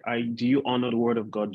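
ordinary talk, nothing marked

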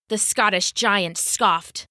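ordinary delivery, nothing marked

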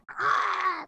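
high, airy mock gasp; a squeaky cartoon intake of breath; cartoonish gasp. Mocking Mitch's bill face with a squeaky gasp. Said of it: "like this".

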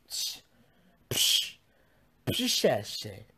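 airy, dismissive psh sounds; repeated hissy psh; scoffing psh. Pretend. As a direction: puff out a mock scoff. Scoffs at own pronunciation trouble.

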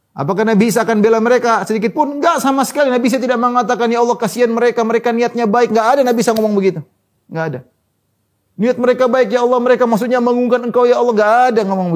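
high, driving sermon voice; impassioned preaching. Acted. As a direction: hammer it home from the pulpit without letting up. Preaching at full force.

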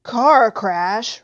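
loud, outraged echo; indignant roar. A narrator's reading. Hagrid hears the lie Harry was told, roaring. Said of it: "roared Hagrid".

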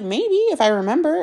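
teasing. Retold or imitated. Teasing boyfriend about texting home.

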